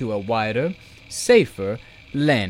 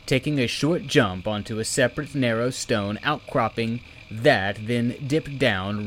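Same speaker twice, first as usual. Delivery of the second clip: ordinary delivery, nothing marked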